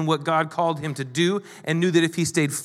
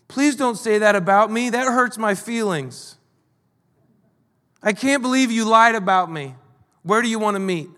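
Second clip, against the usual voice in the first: higher, hammed-up wounded plea; mock hurt; 4 semitones higher than usual. Pastor parodying a touchy reply to a false accuser, mock hurt. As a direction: play the offended victim in a higher pitch.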